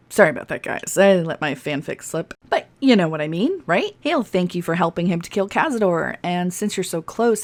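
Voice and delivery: ordinary talk, nothing marked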